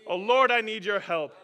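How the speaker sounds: loud plea, falling at the end; pleading prayer. Shown as a demonstration. Preacher repeating the prayer for the crowd, pleading. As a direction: cry it out to God. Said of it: "Someone say that".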